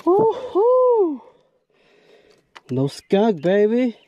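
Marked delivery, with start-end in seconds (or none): none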